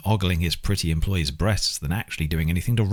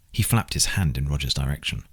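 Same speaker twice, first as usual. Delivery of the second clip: usual speaking voice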